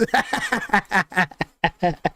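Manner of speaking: helpless real laughter in short bursts; real giggling laugh. Real, not acted. Ranking fighters, can't stop laughing at himself, giggling. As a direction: break up giggling. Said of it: "I don't know why I'm laughing".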